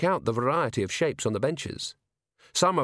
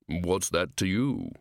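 low, slow shadowy barman; deep rolling voice; 4 semitones lower, slower than usual. A narrator's reading. Barman answers Angua's questioning, deep and rolling. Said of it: "in a deep, rolling voice".

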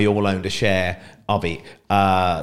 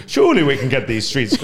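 weak attempt at a Scottish accent; 3 semitones higher, 5 dB louder than usual. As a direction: say it like a Scot, half-hearted. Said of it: "I was gonna do a Scottish accent".

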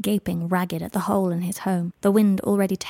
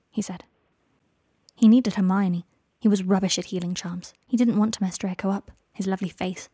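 ordinary delivery, nothing marked

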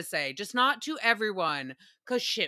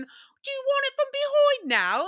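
very high mock British question; squeaky prim British imitation; hammed-up high British voice; British accent; 5 dB louder than usual. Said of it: "and then".

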